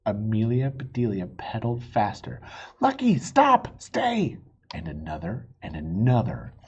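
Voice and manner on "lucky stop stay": high, loud, slow call to the dog; shouting at dog. A narrator's reading. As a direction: command the dog to stop. Owner shouting at runaway dog.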